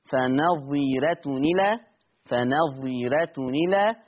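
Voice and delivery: فنظيرة إلى slowly, the hamza's vowel moved onto the ta; slow recitation moving the hamza's vowel back; vowel carried over. Shown as a demonstration. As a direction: join فنظيرة إلى slowly as one flow. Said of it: "النقل يعني تقرأ بنون مكسورة".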